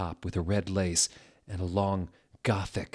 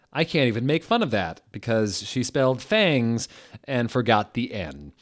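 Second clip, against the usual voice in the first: ordinary talk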